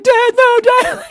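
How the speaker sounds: very high, loud mock begging; desperate pleading. Brother facing the belt, pleading for his life. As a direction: imitate a kid wailing to his father. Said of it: "pleading for his life".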